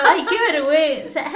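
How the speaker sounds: mock bashful giggle; mocking embarrassment. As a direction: tease her shyness. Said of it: "con tu rosácea diciendo".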